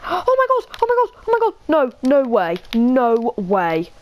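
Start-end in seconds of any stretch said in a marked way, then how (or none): 0.1-1.5 high, rapid oh my god; thrilled. Real, not acted. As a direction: gasp and gush at the sight. Spots a dog, thrilled.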